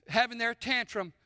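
ordinary talk

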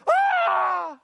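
loud, high startled yelp; hammed-up fright cry; mock terrified scream. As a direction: shriek like a frightened shepherd. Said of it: "and all the shepherds go".

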